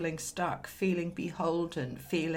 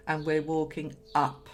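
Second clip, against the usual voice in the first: ordinary delivery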